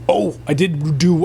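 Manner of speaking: usual speaking voice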